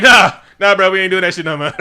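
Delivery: loud dismissive "nah"; brash mock refusal; acted refusal. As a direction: wave him off at full volume. Acted flat refusal of challenge.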